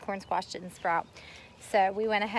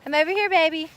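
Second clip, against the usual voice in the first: put-on calling back to a child; 8 semitones higher, 9 dB louder than usual. Retelling her calling back.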